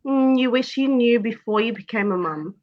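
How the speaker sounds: normal talking voice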